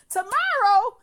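high, loud outraged shout; furious yell. Retold or imitated. Refusing the date's plan to keep her overnight, yelling. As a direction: scream it at him in disbelief.

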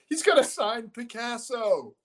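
very high, slow put-on voice; woman's gasp. Retelling a Police Squad gag, high slow put-on voice. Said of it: "she goes".

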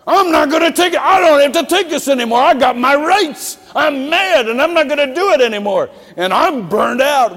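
loud, high whining rant; mock fed-up shout. Preacher imitating a burned-out church member, loud whiny rant. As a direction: rant like someone quitting in a huff. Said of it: "We start imitating that".

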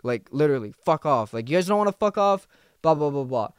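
ordinary talk, nothing marked

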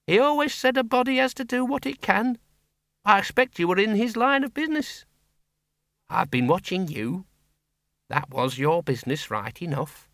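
put-on a blunt old farm woman talking plainly; Miss Flitworth. A narrator's reading.

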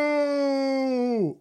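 long, high wailing "no"; mock drawn-out refusal. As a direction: stretch the no out high, tailing down at the end.

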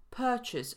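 purchase with long first vowel, no r, weak ending; slowly, purchase with a long schwa. Shown as a demonstration. Teacher giving the English for zakupić, purchase with long schwa. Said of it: "również długie szła".

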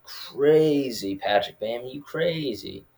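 playful, high-swooping "crazy"; mock amazement. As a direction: tease him with drawn-out disbelief. Mock reaction to mirror flexing.

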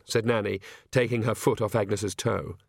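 plain reading voice

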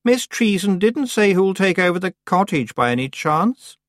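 high, slow, fake offhand; feigned casual. A narrator's reading. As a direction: pretend it just occurred to you. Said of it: "as if she'd only just thought".

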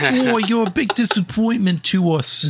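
high, laughing mock English accent; a scolding English mum, hammed up; English parent. Narrator voicing the disappointed English parents: high mock English accent. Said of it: "mimic his English parents".

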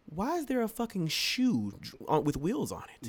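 high, incredulous retold question; asking his friend in disbelief, high-pitched; shocked question. Friend asked in disbelief about the car, high and incredulous.

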